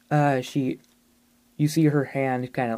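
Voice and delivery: ordinary talk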